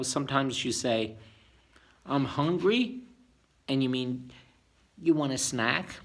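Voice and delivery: ordinary delivery, nothing marked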